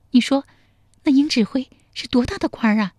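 put-on a feisty young woman grilling him; fierce young woman. A narrator's reading.

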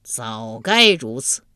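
low, slow, weighty approval; ponderous underworld judge; satisfied King of the Underworld. A narrator's reading. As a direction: draw it out with lordly satisfaction. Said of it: "阎罗天子捋着胡子说".

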